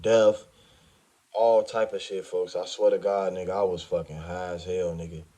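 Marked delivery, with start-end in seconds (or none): none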